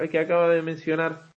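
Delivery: ordinary delivery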